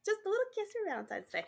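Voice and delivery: high, quick sing-song; cutesy high voice. Pretend. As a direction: coo it like a sweet nothing. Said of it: "What did you just kiss your microphone for".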